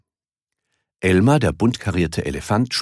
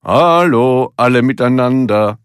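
loud, higher thrown voice; ventriloquist's voice; 5 semitones higher, 7 dB louder than usual. A narrator's reading. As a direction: call out as if from somewhere else. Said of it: "als käme seine Stimme von ganz woanders".

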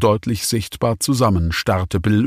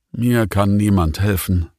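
plain reading voice

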